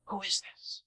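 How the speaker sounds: wary leaders ask each other softly. Retold or imitated. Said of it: "The leaders were saying".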